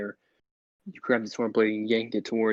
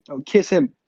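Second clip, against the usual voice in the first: excited exclamation; 3 semitones higher, 6 dB louder than usual. Real, not acted. Gleeful outburst at own idea.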